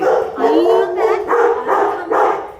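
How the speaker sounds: slow sing-song promise. Real, not acted. Handler promises the waiting dogs she'll return, slow and sing-song. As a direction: stretch it out kindly to the waiting dogs.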